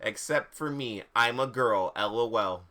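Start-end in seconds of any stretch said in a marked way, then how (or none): none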